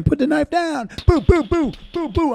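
high, mock police shout with gunfire noises; imitating the cop shouting then shooting; officer yelling. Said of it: "the whole entire time he was saying".

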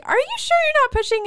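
high, fast, sceptical nurse voice; doubtful nurse. Retold or imitated. Nurse doubting the mom in labor, high and quick. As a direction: quiz her like a suspicious nurse.

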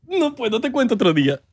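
agudo al empezar, de parodia; niégate con voz cómica y aguda; voz rara fingida. Pretend. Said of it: "por qué estamos hablando así". (negativa impostada)

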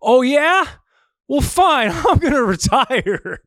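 high mocking tantrum voice breaking into a laugh; mocking Draymond. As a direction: play a sulky athlete storming off. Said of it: "And Draymond was like".

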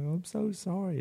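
mildly imitated tearful voice, high and soft; small, high, apologetic quote of someone tearing up; tearful apology, imitated. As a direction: retell it as a soft, upset plea. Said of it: "she started getting teared up".

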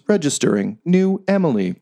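flat machine announcement voice; robot voice. Acted. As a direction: read it like a scanner system. Robot registering a new user.

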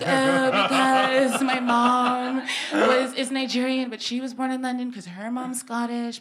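acted exasperation, stretching the first words; exasperated explanation. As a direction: launch loudly into a weary family-tree explanation. Exasperated answer to repeated origin questions.

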